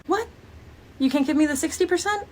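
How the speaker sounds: lightly mock a customer's disbelief; incredulous customer. Customer's incredulous haggling, voiced.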